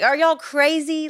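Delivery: high-pitched, exasperated disbelief; mock incredulous. Paul to squabbling believers over who baptized them, mock incredulous. Paul's exasperated reaction, voiced by preacher.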